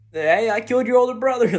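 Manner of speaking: high, loud mock taunt; taunting call. Otogami baiting Eiji to come fire at him, taunting call. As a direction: shout it over to goad him, playfully hammed up. Decoy goading the enemy, imagined.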